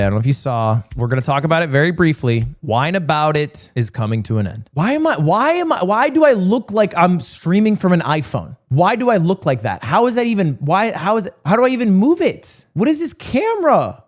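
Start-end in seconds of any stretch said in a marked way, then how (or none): 4.7-7.9 high, bewildered why; baffled by the camera; confused. Real, not acted. Confused by odd camera look. 11.2-14.0 high, puzzled question; stumped by his own camera; baffled. Real, not acted. Baffled by odd camera view.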